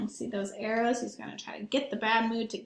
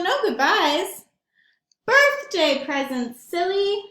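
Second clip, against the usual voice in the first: put-on lively read-aloud for kids; storybook narration; 7 semitones higher, 6 dB louder, slower than usual. A narrator's reading. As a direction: tell it to the children with relish. Reads picture book aloud.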